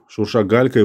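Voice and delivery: ordinary delivery, nothing marked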